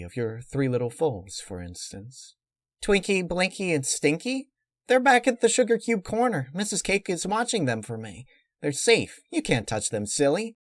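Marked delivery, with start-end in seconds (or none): 2.8-10.6 put-on high-pitched cheerful pony girl; Pinkie Pie voice. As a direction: chirp it up high, unworried.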